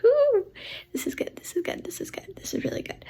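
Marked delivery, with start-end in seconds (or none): none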